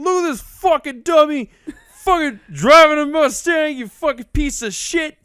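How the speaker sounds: high, loud heckling across a street; retold yelling. Stranger yelling at driver from across the street, abusive. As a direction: imitate a man hollering abuse from afar. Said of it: "Yelling. Yelling from across the street".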